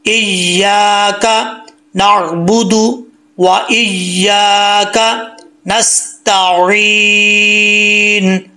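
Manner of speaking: slow, drawn-out melodic chant; tajweed chanting; Quranic recitation. Shown as a demonstration. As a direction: recite each phrase slowly and melodically. Teacher recites Surah Fatiha.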